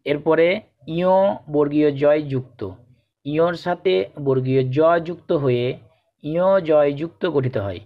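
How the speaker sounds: normal talking voice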